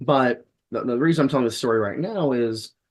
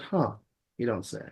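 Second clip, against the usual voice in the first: dry sarcasm; 7 dB quieter than usual. Retold or imitated. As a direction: say it flat and knowing. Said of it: "feeling a little salty".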